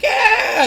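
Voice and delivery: high-pitched short cheer; imitated crowd cheer. Teller mimics fans cheering the match news, high mock cheer.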